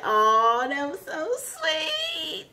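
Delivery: high, drawn-out tearful coo; touched gushing. Real, not acted. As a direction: gush slowly, as if about to cry. Gushing over the reunion scene.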